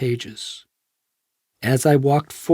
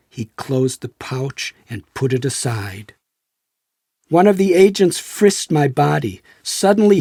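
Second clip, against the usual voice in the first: plain reading voice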